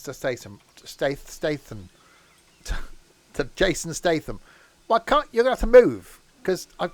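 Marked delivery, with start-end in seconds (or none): 4.9-6.9 higher and louder, annoyed; frustrated outburst. Real, not acted. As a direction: snap at whatever is in the way. Frustrated at something blocking him.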